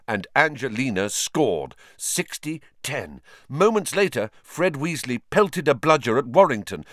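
plain reading voice

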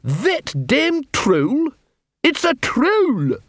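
very high, drawn-out disbelief; indignant contempt. Acted. Captain dismissing the troll prisoner, indignant. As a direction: stretch the words high in outraged contempt.

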